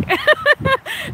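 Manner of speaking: a high, emotional laugh; a laugh breaking through tears; high, choked laugh; tearful laugh. Real, not acted. Moved by fan emails.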